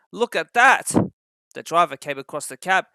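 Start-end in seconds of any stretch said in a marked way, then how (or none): 0.1-0.9 higher, louder alarmed call; alarmed exclamation. A narrator's reading. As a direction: point it out urgently. Fireman spots red flag.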